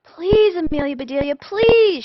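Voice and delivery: high, loud desperate pleading shout; shouted plea. Acted. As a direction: beg her at the top of your lungs. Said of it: "shouted the Grizzlies".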